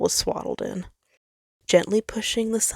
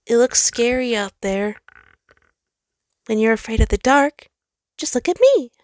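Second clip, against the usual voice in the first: a hint of a high, loud child-like voice; comforting star; 6 semitones higher, 6 dB louder than usual. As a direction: reassure someone afraid of the dark. Sol's character voice.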